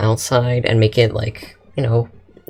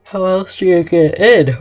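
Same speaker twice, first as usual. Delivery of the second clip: words stretched by a yawn; 7 semitones higher, 4 dB louder than usual. Real, not acted. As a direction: talk through a big yawn. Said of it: "I don't know why I'm yawning".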